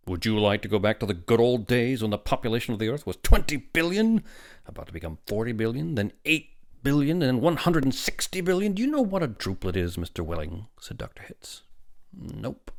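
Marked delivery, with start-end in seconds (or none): 0.1-10.7 loud, escalating, high-pitched harangue; severe lecturing. A narrator's reading. Doctor lectures the father on population, severe. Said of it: "became rather severe with Welling". 12.1-12.7 soft, sulky refusal. A narrator's reading. Father answers the doctor's question, sulkily. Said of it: "said Welling sulkily".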